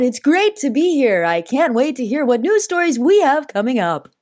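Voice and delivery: newsreader voice. Pretend. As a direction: read it like a chirpy news anchor. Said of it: "my newsreader voice".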